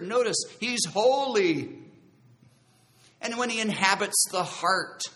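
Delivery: loud, stretched-out key words, rising intensity; emphatic preaching. Real, not acted. Preacher explains conviction of sin, forceful and emphatic. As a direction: preach it with force, drawing out each point.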